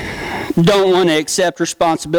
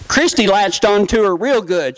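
usual speaking voice

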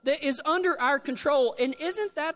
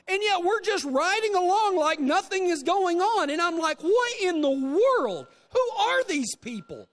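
retold disbelief; 7 semitones higher than usual. Preacher retelling his shock to the congregation, incredulous. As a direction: throw up your hands at their calm.

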